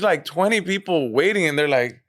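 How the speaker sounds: usual speaking voice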